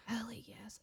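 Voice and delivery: soft, sleepy mum answering the phone; groggy, hushed reply; waking-up voice. Retold or imitated. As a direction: imitate someone just woken, very quiet. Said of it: "her waking up voice".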